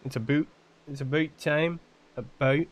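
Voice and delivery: mock Canadian accent, "about" turned to "a boot". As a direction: imitate a Canadian saying "about". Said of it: "asking my Canadian friend".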